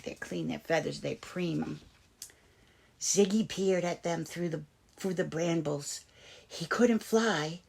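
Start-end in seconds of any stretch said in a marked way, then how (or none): none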